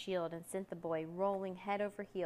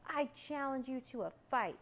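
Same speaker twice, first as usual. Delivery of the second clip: high, bold challenge; mildly shouted, pitched up as a challenge; 6 semitones higher than usual. A narrator's reading. Boy challenges the dragon to fight, raised-voice challenge. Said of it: "shouted the boy".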